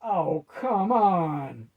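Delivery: drawn-out whiny groan; complaining. A narrator's reading. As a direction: moan it out slowly like a sulky demon. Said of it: "complained Morketh".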